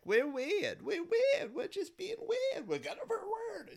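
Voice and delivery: very high, squeaky mock of quirky sketch characters; mocking weird voice. Mocking nineties SNL sketches, in a very high squeaky mock voice. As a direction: squeal it like a self-consciously zany sketch. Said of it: "sketch after sketch where it's just like".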